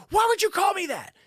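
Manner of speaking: very high, loud outraged shriek; hammed-up shrill-pitched outburst; mock crazy scream. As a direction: imitate an offended woman screaming. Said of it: "she starts screaming crazy at him".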